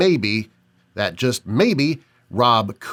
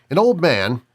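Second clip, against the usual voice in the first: usual speaking voice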